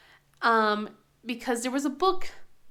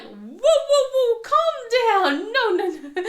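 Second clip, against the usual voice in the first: high, loud, drawn-out mock alarm; retold frantic whoa, breaking into laughter; alarmed calming down; 12 semitones higher, 10 dB louder, slower than usual. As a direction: call out to rein in an overexcited kid, half laughing. Voices reining in grabbing son.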